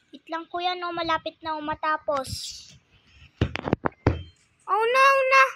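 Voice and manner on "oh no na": high, loud dismay; panicked outcry. Real, not acted. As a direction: cry out in alarm. Game disconnects, upset outcry.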